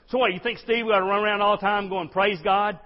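high, loud challenge; exuberant objection. Acted. Church member questioning Steve's exuberance, loud and over the top. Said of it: "as exuberant and over the top as I am".

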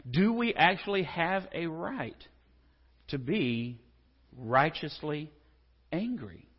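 slow, with pauses before "righteously" and "angry?"; deliberate pauses, each word alone; drawn-out question. Shown as a demonstration. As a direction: space out each word to make them think. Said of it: "spaced rhetorical question".

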